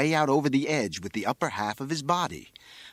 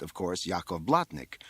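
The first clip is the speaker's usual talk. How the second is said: plain reading voice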